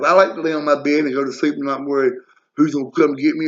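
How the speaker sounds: ordinary talk, nothing marked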